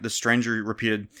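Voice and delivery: plain reading voice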